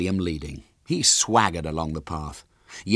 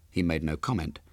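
ordinary delivery, nothing marked

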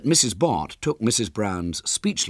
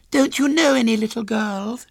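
put-on very high, louder child voice; pitying little girl; 5 dB louder than usual. A narrator's reading. Small girl pities William, high and childish. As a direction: pity him in a squeaky girl's voice. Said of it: "she said pityingly".

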